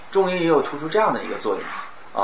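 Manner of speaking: ordinary delivery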